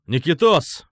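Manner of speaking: searching shout, moderately voiced; calling out. A narrator's reading. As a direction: call to someone out of sight. Calling the hidden friend by name.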